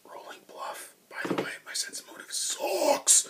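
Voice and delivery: whispered confession to the listener; whispered aside. Real, not acted. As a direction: breathe it out as a secret. Aside about his own dice.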